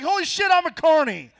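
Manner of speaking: high, loud sudden shock; shocked realization. Retold or imitated. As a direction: blurt it like a dawning horror. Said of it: "I was like".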